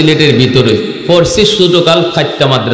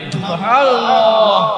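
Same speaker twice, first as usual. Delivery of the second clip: awed exclamation; 6 semitones higher, 5 dB quieter, slower than usual. Acted. Awed exclamation in a miracle story.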